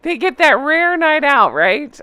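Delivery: normal talking voice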